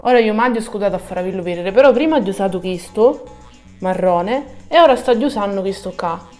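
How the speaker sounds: ordinary talk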